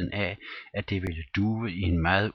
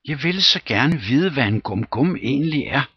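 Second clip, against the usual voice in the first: put-on old lady voice; 7 semitones higher, 6 dB louder than usual. Old lady wishing for the ending.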